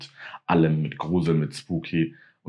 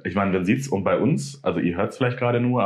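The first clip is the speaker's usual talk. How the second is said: ordinary talk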